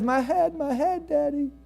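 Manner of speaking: put-on high, whimpering little boy's voice; child in pain. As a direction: sound like a small boy with a sore head. Sick boy cries to father.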